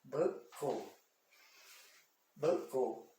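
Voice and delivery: "Buckle" broken into buck and kle; syllable by syllable. Shown as a demonstration. Teacher separating the syllable for learners, "Buckle" said in two pieces. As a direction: leave a clear gap inside each "Buckle". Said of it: "separei a fra-, a sílaba".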